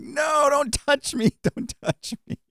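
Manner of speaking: very high, drawn-out comic wail, fading into laughter; mock panicked protest. Preacher imitating the patient as kids pray over him, mock panicked protest. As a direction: squeal a slow, exaggerated refusal, then laugh.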